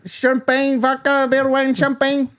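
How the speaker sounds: high, monotone repeated chant of drinks; imitated drink seller. Messenger boy touting drinks from a bucket, high repetitive call. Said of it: "he's basically just going like".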